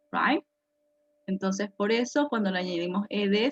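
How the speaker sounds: ordinary talk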